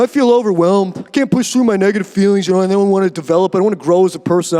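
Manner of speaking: low, fast mock whine of self-pity; whiny quitter. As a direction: sulk like someone making excuses to give up. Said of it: "It's like".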